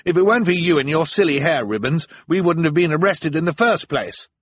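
high, loud accusing rant; angry retort. Acted. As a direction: blame her, heated. Klaus snaps back in quarrel.

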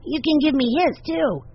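put-on high-pitched, greedy-for-dessert child; eager little boy. A narrator's reading. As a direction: pipe up high and hopeful. Benny eagerly claims second dessert.

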